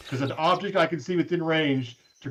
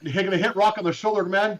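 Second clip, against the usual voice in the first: usual speaking voice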